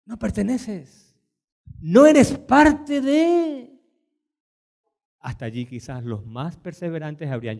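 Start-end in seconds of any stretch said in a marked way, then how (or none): 0.0-3.8 slow, building to a drawn-out shout on no; slow rising cry of rejection; voiced rejection. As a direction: stretch each word and shout the last. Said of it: "el Señor mismo le dice".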